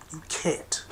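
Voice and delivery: whispered you can't. Real, not acted. Hammering his point to listeners, whispered.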